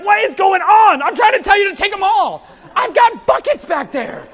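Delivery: high, loud, baffled shout; mock exasperated shout. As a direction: yell in disbelief at the crowd. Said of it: "I'm like".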